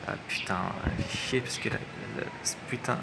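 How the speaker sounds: whispered frustrated swearing; cursing under breath. Real, not acted. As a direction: hiss the curses under your breath. Curses at the vanishing plane.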